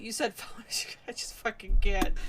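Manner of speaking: unvoiced muttered aside; whispered mutter. Real, not acted. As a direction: say it under your breath. Mocking aside.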